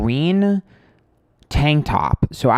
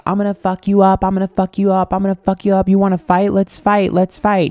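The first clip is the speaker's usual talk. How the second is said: higher mock yell of a threat; retold threat shout; 6 semitones higher, 3 dB louder than usual. Friend yelling at the truck driver, a mild mock yell. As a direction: replay a friend's fighting threats, half-shouted. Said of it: "the person I'm with is screaming".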